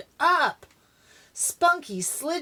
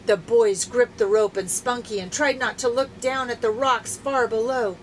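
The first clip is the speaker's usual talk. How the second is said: usual speaking voice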